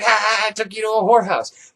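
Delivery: lightly voiced gloating crone; a hint of a smug old woman laughing; mock cackling old woman. Old woman gloats to the narrator, mock cackle.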